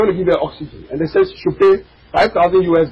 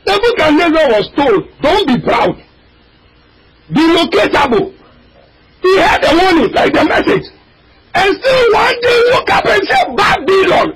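fiery preaching; 9 semitones higher than usual. Acted. Preacher crying out to the congregation, fiery. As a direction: preach it out, voice cracking high.

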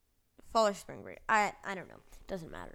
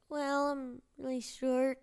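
put-on high, slow upset kid voice; mock-crying kid; 6 semitones higher than usual. Third grader explaining the bullying, high tearful voice. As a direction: imitate a third grader near tears. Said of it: "I was, like, crying".

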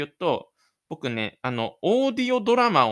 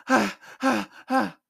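panting; 6 semitones higher, faster than usual. Acted. Panting after running to bus.

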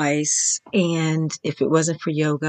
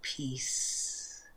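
faint, softer, lower whisper; 4 semitones lower, 16 dB quieter than usual. Acted. Teacher settling listeners into stillness, in a faint whisper. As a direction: breathe the word out like a sigh. Closing word of a meditation phrase.

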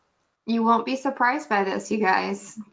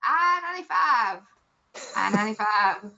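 put-on old bingo call. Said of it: "It used to be like".

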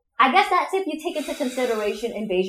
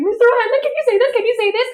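high, louder imitation of an eager pupil; pestering pupil; 11 semitones higher, 6 dB louder, faster than usual. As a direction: nag the teacher excitedly, mimicking your younger self. Retells pestering Irish teacher.

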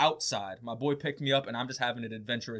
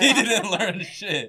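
slow, louder laughing verdict; laughing, mocking repeat; laughing tease; 7 semitones higher, 8 dB louder, slower than usual. Real, not acted. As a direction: drag it out as you crack up.

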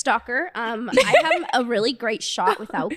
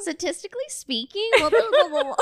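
laughing know-it-all; 9 semitones higher than usual. Retold or imitated. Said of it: "that would be me".

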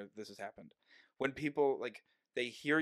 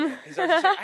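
words said through a laugh; 15 dB louder than usual. Real, not acted. Telling about messing up the song, laughing. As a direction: laugh as you tell it.

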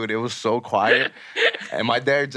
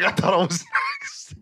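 high, slow words through laughter; retold laughing fit; slower than usual. Imitating friend rolling on the floor, helpless high laughing. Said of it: "He was dying".

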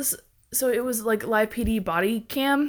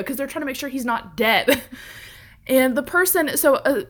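ordinary talk, nothing marked

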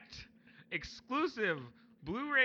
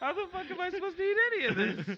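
cat voice; 8 semitones higher, faster than usual. Acted. Cat complaining.

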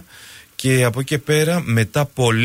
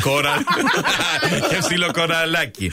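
higher, through giggles; laughing; 6 semitones higher than usual. Real, not acted. Guest fumbles the tongue twister, laughing. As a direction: finish the tongue twister while cracking up.

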